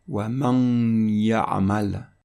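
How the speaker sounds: slow recitation of "Wa man ya'mal", long nasal glide from "man" into "ya'mal"; "Wa man ya'mal" recited slowly, n melted into y; nasal assimilation. Shown as a demonstration. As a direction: draw out the nasal hum before the y of "ya'mal". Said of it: "on va prononcer man ya".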